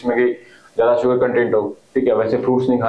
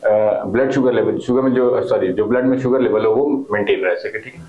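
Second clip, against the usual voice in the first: normal talking voice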